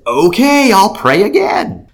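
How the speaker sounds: loud, high, drawn-out retold reply; eager agreement. Man offering another prayer, eager agreement.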